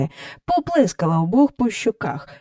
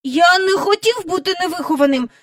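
high-voiced child making excuses; protesting little boy; 11 semitones higher, faster than usual. A narrator's reading. Michael excusing himself to Miss Andrew, protesting. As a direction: plead innocence in a high voice.

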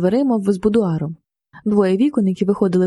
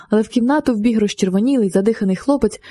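ordinary delivery, nothing marked

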